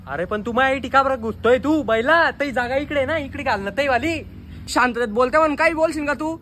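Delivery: loud, heated neighbour shouting back; furious shouting at the neighbour; angry yelling. Acted. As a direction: yell at him across the street. Neighbours quarrel over garbage.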